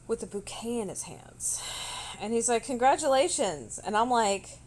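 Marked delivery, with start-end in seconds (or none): 2.6-3.8 bright, loud, upbeat congratulating; cheerful congratulations. Retold or imitated. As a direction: announce it like good news. Cupcake voiced congratulating her.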